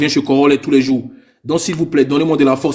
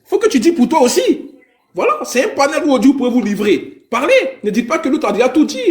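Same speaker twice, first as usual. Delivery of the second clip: much higher, urgent scolding; worked-up scolding; 10 semitones higher than usual. Real, not acted. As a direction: push the callers hard, rising. Scolding the callers.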